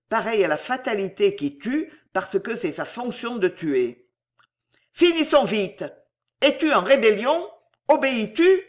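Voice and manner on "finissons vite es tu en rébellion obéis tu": high, loud commanding queen; imperious demand. A narrator's reading. Fausta presses the executioner to obey, imperious. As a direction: demand obedience, impatient.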